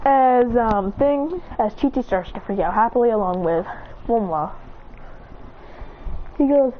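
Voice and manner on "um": ordinary delivery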